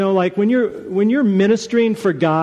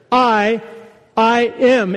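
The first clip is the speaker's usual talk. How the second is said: emphatic "I", set apart by a pause; 2 semitones higher, slower than usual. Shown as a demonstration. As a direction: stress on "I", said alone first, then in "I am".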